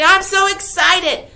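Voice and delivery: teacher demoing how excited sounds; mock excitement. Shown as a demonstration. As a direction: sell it like an exclamation point. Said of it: "get excited".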